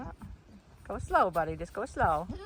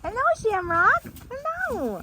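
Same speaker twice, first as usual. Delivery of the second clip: much higher sing-song hello; high pet voice; 13 semitones higher than usual. Real, not acted. Greeting animal in pet voice.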